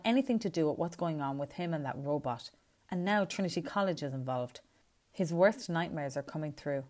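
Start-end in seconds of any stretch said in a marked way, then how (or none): none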